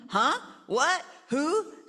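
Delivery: high startled confusion. Acted. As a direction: jolt awake, confused. Parents startled.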